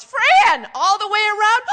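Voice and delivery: very loud, high mock shout; shouting dad. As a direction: bellow directions at the driver. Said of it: "And he shouts".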